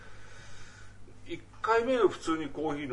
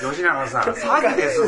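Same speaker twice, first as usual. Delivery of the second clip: mock warning; 7 semitones higher, 7 dB louder than usual. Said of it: "みたいな context".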